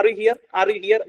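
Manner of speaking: normal talking voice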